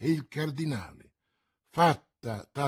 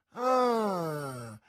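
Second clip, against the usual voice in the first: exclamation. A narrator's reading. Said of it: "esclamò Jussac".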